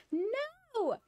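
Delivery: high, drawn-out cry of "no"; mock dismay. Reacting to Steph still being sick, mock dismay. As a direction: wail it in playful, exaggerated dismay.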